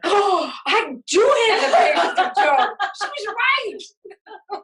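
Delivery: a mother finally getting the joke, laughing; mother laughs out her realization to a friend. Retold or imitated. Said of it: "and I went".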